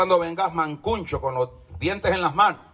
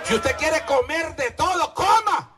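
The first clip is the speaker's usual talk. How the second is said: preaching shout; 8 semitones higher than usual. Real, not acted. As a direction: shout the dare at the flock. Preacher daring the congregation.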